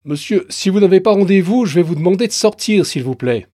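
plus haut et fort, pressé; vigile poli mais ferme. Acted. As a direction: ordonner de sortir, poliment mais sans appel. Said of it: "Le ton est poli, mais ferme". (vigile corpulent, ton poli mais ferme)

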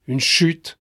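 ordinary delivery

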